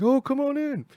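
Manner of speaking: high, loud call of welcome. Retold or imitated. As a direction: wave them in with a high-pitched holler. Plug calls them inside.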